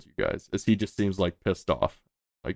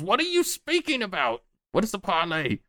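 high, baffled goblin voice; confused goblin; 11 semitones higher than usual. Acted. Goblin guard puzzled by the prisoner, high and squeaky. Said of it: "he says".

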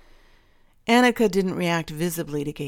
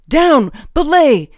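loud, high-pitched urgent shout; shouted command; 10 semitones higher, 14 dB louder, slower than usual. A narrator's reading. Calix orders the hound down, shouting. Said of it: "Calix shouted".

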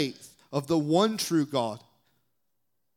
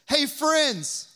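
high, loud friendly hail; calling out; 10 semitones higher, 4 dB louder than usual. Retold or imitated. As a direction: shout a cheerful greeting to the boat. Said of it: "he says".

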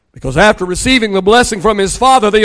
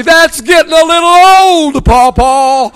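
lightly voiced toddler, a bit higher and louder; child's teasing voice; 6 semitones higher, 5 dB louder, slower than usual. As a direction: tease grandpa like a little girl would. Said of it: "she's gonna say".

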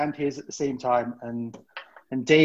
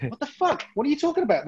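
high-pitched incredulous questions; acted bafflement; 12 semitones higher, faster than usual. Crewman baffled by the laughing staff, acted bafflement. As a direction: pitch it up in mock confusion.

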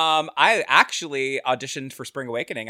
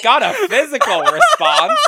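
laughs through the words, much higher; laughing; 11 semitones higher, 8 dB louder than usual. Real, not acted. Cohost laughing at a bad joke, high and breaking. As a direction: crack up while saying it.

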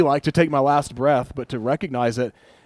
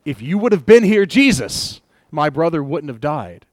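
loud, high, raw reproach to Jesus; anguished cry; 3 semitones higher, 7 dB louder than usual. Retold or imitated. Grieving Martha cries out at Jesus, loud and anguished. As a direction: cry out the accusation, hurt and demanding.

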